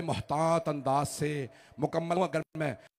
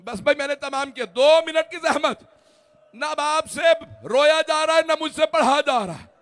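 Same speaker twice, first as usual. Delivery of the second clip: full-force majlis preaching; 13 semitones higher, 11 dB louder than usual. Real, not acted. Preacher pouring the grief of the martyrdom over the mourners, loud and high. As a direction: cry out the mourning lines to the whole gathering.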